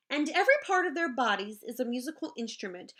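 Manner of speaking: usual speaking voice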